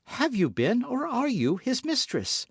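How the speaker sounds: high, urgent, rising demand; jealous demand. A narrator's reading. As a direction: force out the answer in jealousy. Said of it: "with an energy".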